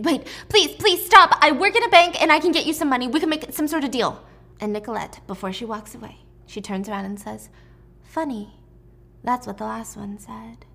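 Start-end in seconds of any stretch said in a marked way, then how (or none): 0.0-4.2 high, loud, frantic begging; acted screaming plea. Tuesday begs the guards holding a knife, acted screaming plea. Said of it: "she starts screaming". 8.0-10.7 softer, half-whispered aside; cold parting remark. Acted. Villain turns before walking away, quiet cold remark.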